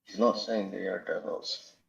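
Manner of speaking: signal cutting out, voice very faint; radio breaking up. Real, not acted. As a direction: voice fading in and out on a bad line. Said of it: "your voice is gone".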